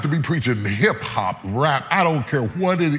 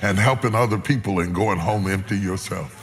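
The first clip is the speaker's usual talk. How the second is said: lower, gentle, reflective; tender preaching; 6 semitones lower than usual. Acted. Preacher comforting listeners about greatness, low tender voice. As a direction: ease down and speak it gently.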